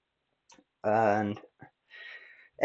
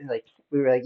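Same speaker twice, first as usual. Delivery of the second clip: ordinary delivery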